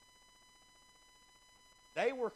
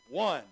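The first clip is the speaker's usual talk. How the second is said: one hit much louder after a pause; pause, then slam one; stress on one; 11 dB louder than usual. Shown as a demonstration. Preacher stressing the woman's one lost coin. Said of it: "underline that".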